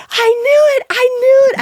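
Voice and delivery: high, loud squeal of joy; ecstatic cheering. Retold or imitated. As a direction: shriek it like you just won. Joyful shout at first order.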